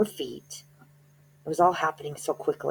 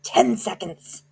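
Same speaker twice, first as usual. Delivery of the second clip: higher, slow witch voice; witch counting down; 7 semitones higher, 4 dB louder, slower than usual. A narrator's reading. Grand High Witch counting down to the audience, high and slow. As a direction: count it slowly like a gloating witch. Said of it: "cried the Grand High Witch".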